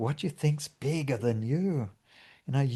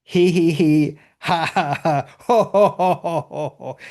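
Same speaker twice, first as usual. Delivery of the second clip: loud high he he, ha ha, ho ho laughs climbing through the three levels; laughter yoga demo; 5 semitones higher, 7 dB louder than usual. Shown as a demonstration. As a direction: belt out snicker, heart laugh, then belly laugh. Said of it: "I'd just start to laugh at it".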